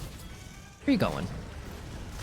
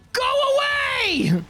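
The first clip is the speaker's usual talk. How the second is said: high drawn-out yell, much higher; frantic shout; 14 semitones higher, slower than usual. Real, not acted. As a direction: yell it at the monster in panic. Monster chasing, shout.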